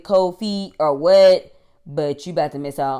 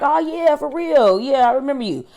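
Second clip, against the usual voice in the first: mock bro greeting; 9 semitones higher, 4 dB louder than usual. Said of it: "He's like".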